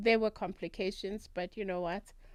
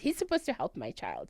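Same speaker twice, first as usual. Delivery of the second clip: ordinary delivery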